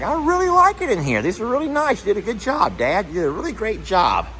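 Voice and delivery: very high, sweet pony voice; voicing the horse Roman. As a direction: squeak it out like a happy pet thanking his dad. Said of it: "Roman's like".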